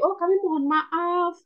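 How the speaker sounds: pleading, lilting customer-service regret; empathetic apology. Shown as a demonstration. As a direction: apologise as if you feel their trouble. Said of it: "intonasinya harus gimana cara berempati".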